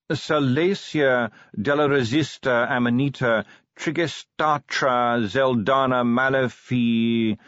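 stately, lingering roll-call of names; drawn-out recitation. Acted. Ruler reading a long name from his paperwork, slowly intoned.

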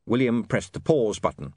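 ordinary delivery, nothing marked

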